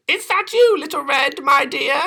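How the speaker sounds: very high, quavery granny; kindly old granny. Acted.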